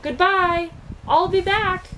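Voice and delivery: high loud calling out; shouted goodbye. A narrator's reading. Milo leaving, calling to friends, loud and high. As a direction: shout it back down the road. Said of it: "he shouted".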